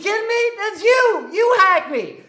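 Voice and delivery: high-pitched acted outrage; outraged accusation. Acted quarrel with the hacker.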